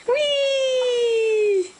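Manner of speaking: high, drawn-out excited squeal; playful whee. Real, not acted. Playing airplane with the baby.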